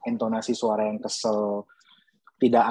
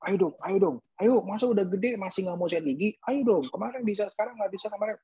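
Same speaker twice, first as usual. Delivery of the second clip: higher, pushy, impatient coaxing; nagging parent; 7 semitones higher than usual. Retold or imitated. Parent pressuring child who refuses to brush teeth, impatient nagging. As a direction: nag the child like you're out of patience. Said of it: "bukan cuma".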